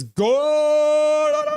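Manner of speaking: high, loud held go; shouted cheer. Retold or imitated. Streamer voicing a chatter yelling go. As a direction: belt it out as a fan. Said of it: "Big Hog says".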